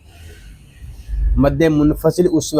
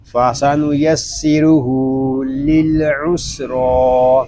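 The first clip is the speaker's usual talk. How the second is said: slow recitation with long held vowels; Quran recitation; much slower than usual. Shown as a demonstration. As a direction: recite slowly, drawing out the long syllables. (धीमी तिलावत, खींचकर)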